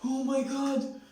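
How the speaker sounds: high, thrilled exclamation; a kid starstruck by his hero; excited gasp. Retold or imitated.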